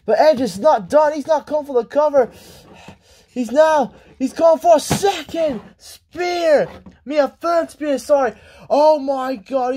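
high, astonished wrestling call; worked-up match commentator; excited play-by-play. Acted. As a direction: cry out each big hit. Live play-by-play, repeated spears.

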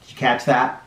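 ordinary delivery, nothing marked

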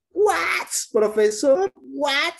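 squeaky high mock student protest; mock student squeal of disbelief; incredulous student. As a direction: play a stunned pupil squealing what. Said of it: "student voice addressing profesor".

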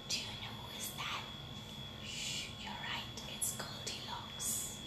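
very soft whispered aside; conspiratorial whisper. A narrator's reading. Narrator asking children who broke it, whispering.

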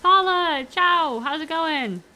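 loud high cheerful hello; bright loud greeting; excited greeting. Real, not acted. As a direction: greet a viewer with a burst of joy. Greeting a viewer.

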